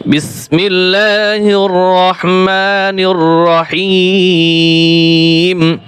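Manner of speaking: slow, drawn-out melodic recitation; Quran recitation. Shown as a demonstration. Teacher opens the Quran class with bismillah, slow melodic recitation. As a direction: recite with long, sustained vowels.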